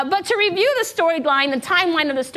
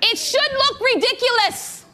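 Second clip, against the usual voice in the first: emphatic high; 8 semitones higher, slower than usual. Real, not acted. Preaching emphasis.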